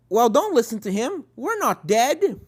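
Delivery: bossy pony. Acted. Friend reassures.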